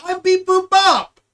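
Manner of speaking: high, loud, slow robot voice; booming robot self-introduction; robot announcement. Pretend. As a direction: declare it like a proud robot.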